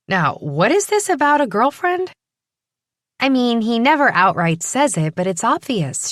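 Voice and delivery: put-on young woman voice. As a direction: voice her as a curious young woman. Primrose's dialogue voiced higher.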